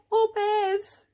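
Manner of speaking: high gasp and a loud, high "or bears"; startled gasp before the big scary one; storyteller's mock-scared gasp at bears; gasp then bears. A narrator's reading.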